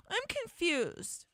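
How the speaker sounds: very high, drawn-out whine; mock confused. Host mimicking the email phrase she hates, mock confused. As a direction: whine it slowly, mocking the emailer. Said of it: "what phrase I just hate".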